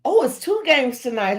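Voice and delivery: usual speaking voice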